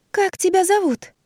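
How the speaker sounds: high, thin, slow put-on voice; unnaturally thin voice. A narrator's reading. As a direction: squeak it out in a thin falsetto. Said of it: "неестественно тоненьким голосом".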